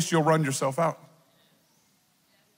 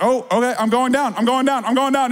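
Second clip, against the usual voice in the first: high, loud hammed-up going down; mock panic; 5 semitones higher, 5 dB louder than usual. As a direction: flail as you sink, half joking. He is pushed down by God.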